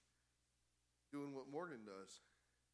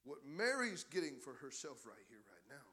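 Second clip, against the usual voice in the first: gentle Jesus. Acted. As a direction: answer her gently, almost hushed. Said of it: "Jesus looks at Martha and he says".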